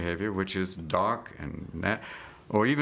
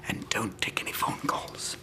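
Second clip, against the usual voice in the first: slow hushed whisper; 5 dB quieter, slower than usual. Pretend. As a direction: hush him gently, slow and secret. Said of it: "I wanna say".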